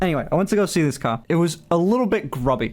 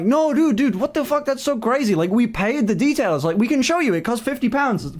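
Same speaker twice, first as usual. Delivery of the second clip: higher, hammed-up bro voice of a dealer; mock dealership guy; 8 semitones higher than usual. Retelling the dealership staff's reply, in a high, mocking bro voice. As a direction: play the clueless salesman, pitched way up and mock-shocked. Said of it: "And they went like".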